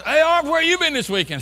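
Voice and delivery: high, loud mock greeting from an old friend; friend calling out. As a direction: call out like a surprised buddy. Friends greet him, retold.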